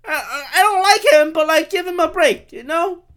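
high, hammed-up mom voice. Mocking the media as his mom, high pitched mom voice. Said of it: "The media is like my mom".

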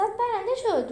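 high, loud, triumphant cry; excited shout. A narrator's reading. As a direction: shout out as if jumping from your seat. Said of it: "جولیوس از جایش پرید و داد زد".

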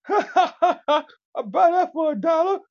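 laughing loudly through the words; high, loud cracking-up laugh; can't stop laughing mid-line. Real, not acted.

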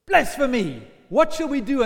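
loud, furious cry of blasphemy; outraged high priest. Acted. High priest shouting at the prisoner, enraged and loud. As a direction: bellow it in outrage. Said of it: "Caiaphas is so cross".

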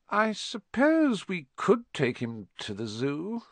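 slow, thoughtful high aunt voice; slowly. A narrator's reading. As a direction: draw the words out as she thinks. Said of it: "said Aunt Petunia slowly".

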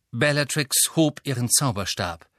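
usual speaking voice

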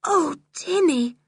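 slow, high, tearful-sounding sigh of the name; overcome, talking to a beloved dog; shaken relief. Acted. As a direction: draw it out, high and emotional.

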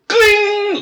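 very high sung ping; notification ding. Retold or imitated. Imitating a phone alert for an unwanted pic, high chime.

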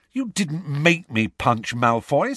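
Harry angrily refusing Ron's self-blame; angry. A narrator's reading. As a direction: push back hard at his friend. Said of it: "said Harry angrily".

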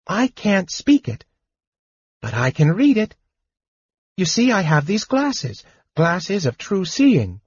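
a small kender bursting with news; chattering high kender, excited. Acted. Tas the kender's voice.

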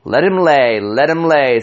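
big man gloating after knocking the short man down; loud brag. A narrator's reading. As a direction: crow over the fallen man.

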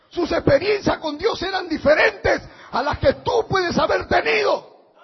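loud, high, impassioned sermon delivery; fiery preaching. Acted. Pastor thunders to his congregation about David's heart, fiery preaching. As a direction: preach it out at full force to the flock.

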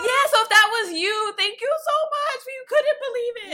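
high, loud, excited thanks; excited gushing. Real, not acted. Thanking the listener who came up to them, excited gushing. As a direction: gush it at the top of your range.

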